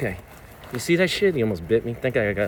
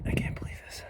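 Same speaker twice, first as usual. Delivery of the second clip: stunned whisper; 7 dB quieter than usual. Real, not acted. Stunned while freeing the birds.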